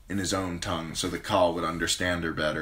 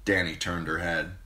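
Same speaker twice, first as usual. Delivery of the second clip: usual speaking voice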